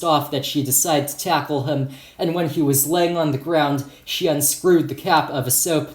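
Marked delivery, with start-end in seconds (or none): none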